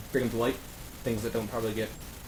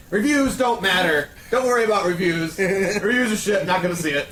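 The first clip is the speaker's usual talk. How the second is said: mocking a co-host; 6 semitones higher, 5 dB louder than usual. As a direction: parody his dismissive verdict. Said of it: "the same person every time".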